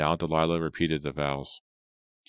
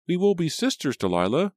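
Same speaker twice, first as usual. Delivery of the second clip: put-on very high, loud little-girl squeal of words; Rachel exclaiming; 13 semitones higher, 6 dB louder than usual. A narrator's reading. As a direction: burst out like a thrilled small girl. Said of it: "Rachel exclaimed".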